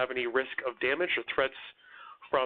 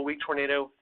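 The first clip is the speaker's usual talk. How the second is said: usual speaking voice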